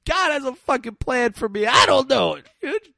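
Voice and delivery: high, loud mock wail; hysterical crying. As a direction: imitate a grieving mother losing it. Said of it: "And she's crying".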